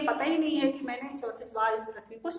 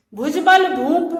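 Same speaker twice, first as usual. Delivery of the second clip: slow, loud, raised-pitch declamation; verse recitation; 4 semitones higher, 9 dB louder, slower than usual. Shown as a demonstration. Teacher quoting Parashuram's boast, slow loud recitation. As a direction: draw the verse out loudly.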